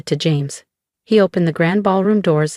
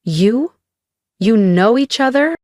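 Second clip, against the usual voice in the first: higher, rising disbelief; stunned; 6 semitones higher, 4 dB louder than usual. A narrator's reading. Mother sees daughter knows the Lord, stunned. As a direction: blurt it as a stunned question. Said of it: "her stunned expression".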